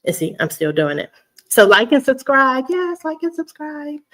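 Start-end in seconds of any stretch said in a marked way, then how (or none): 1.5-2.6 loud, higher-pitched call to subscribe; bubbly and projected. Real, not acted. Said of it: "I get all bubbly". 2.7-4.0 very high, softer bubbly voice; giddy high-pitched repeat. Real, not acted. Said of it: "I get all bubbly".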